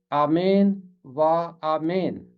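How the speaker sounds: आमीन व आमीन as 'aamen va aamen', with pauses; Hebrew reading. Shown as a demonstration. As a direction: read आमीन व आमीन the Hebrew way, '-men' endings. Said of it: "इब्रानी में इसे पढ़ते हैं".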